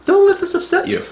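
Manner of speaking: high-pitched, laughing read-out; words tipping into real laughter; laughing while quoting. Real, not acted. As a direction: crack up as you read the quote. Breaks into laughter while quoting David.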